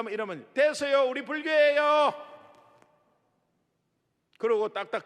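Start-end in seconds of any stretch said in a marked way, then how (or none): 0.5-2.1 raised voice, higher, shutting them down; curt loud brush-off. Retold or imitated. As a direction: snap back through the door. Mother rebuffs evangelist. (문 너머로 높고 크게 딱 자르듯)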